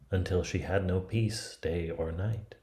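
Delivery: ordinary delivery, nothing marked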